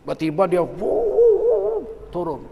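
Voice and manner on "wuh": high drawn-out "wuuh"; imitated whoosh. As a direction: mimic a sudden whoosh. Meniru mobil tiba-tiba datang. (tiruan bunyi "wuuh" yang tinggi)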